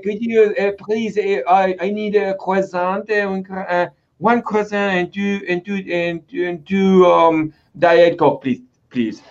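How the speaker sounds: put-on slow, hesitant French-accented English; light mock French accent. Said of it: "con el acento francés".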